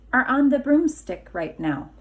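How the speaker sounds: ordinary delivery, nothing marked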